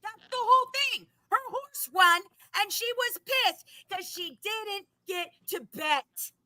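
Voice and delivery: upset, heated. Real, not acted. Ranting to cohost about the lost bet, heated and loud. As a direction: let the anger spill out. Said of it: "take it down a notch".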